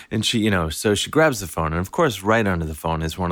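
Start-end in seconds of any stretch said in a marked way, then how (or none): none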